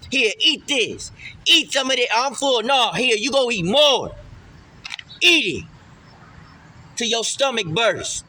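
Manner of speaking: loud, high, insistent commands to eat; mock force-feeder. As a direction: order them to eat, pushing harder each time. Voices force-feeding someone their karma.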